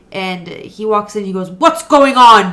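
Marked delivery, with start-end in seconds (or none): 1.5-2.5 loud, slow demanding dad; startled dad. Retold or imitated. Dad walking in on the party, loud slow demand. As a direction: bark it as the dad catching them.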